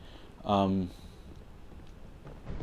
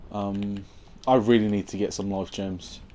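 ordinary delivery

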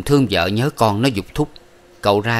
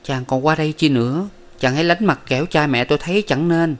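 higher, worried and cool; frowning wife; 7 semitones higher than usual. A narrator's reading. Ngọc Thế frowns and warns her husband away, higher. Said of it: "chau mày ngó hỏi rằng".

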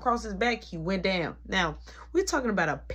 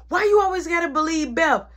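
loud, high-pitched protest as the brother; enraged and upset; 7 semitones higher, 7 dB louder than usual. Retold or imitated. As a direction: burst out, fed up and indignant. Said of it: "Mark responded enraged and upset".

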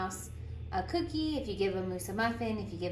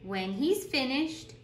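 lively storytime voice, bouncing pitch; animated picture-book reading; reading to children; 3 semitones higher than usual. Acted. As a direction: read it like a teacher at story circle. Reads picture book aloud.

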